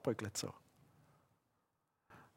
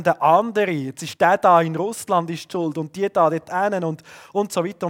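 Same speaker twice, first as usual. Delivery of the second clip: earnest spoken prayer, somewhat louder; 7 dB louder than usual. Acted. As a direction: pray aloud before the congregation. Betet zum Schluss.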